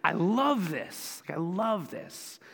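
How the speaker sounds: slow, drawn-out love of the dish; savoring delight. Retold or imitated. Pastor mimicking someone raving about curry ramen, slow and savoring. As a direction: linger over love like tasting great ramen. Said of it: "you're just like".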